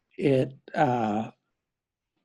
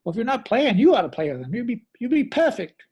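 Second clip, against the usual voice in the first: Clem's voice; 8 semitones higher, 3 dB louder, faster than usual. Retold or imitated. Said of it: "He goes".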